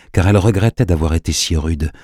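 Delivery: ordinary delivery, nothing marked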